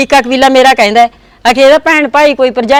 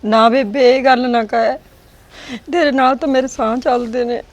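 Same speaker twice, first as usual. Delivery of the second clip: soft, tearful plea; hushed and upset, voice sinking; tearful pleading; 7 dB quieter than usual. Acted. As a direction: plead quietly, close to tears. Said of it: "ਰੋ ਨਾ".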